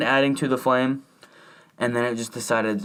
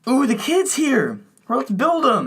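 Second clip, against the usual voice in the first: high, slow mock-cheerful voice; mock excited parent; 12 semitones higher, slower than usual. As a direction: ham up a giddy parent unboxing a kid. Mocking the movie's parents.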